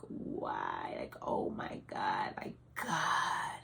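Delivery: hushed, breath-only cry to God; whispered mock scream. Mother alone with her baby crying to God, whispered mock scream. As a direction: whisper the scream, drawn out and stifled. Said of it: "like, screamed".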